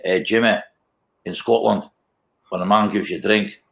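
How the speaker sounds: put-on Scottish accent; Scottish pub bloke. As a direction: imitate a Scot laying down the law. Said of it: "he went after me and said".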